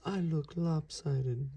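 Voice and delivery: a mild pout; glum. Acted. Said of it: "he said".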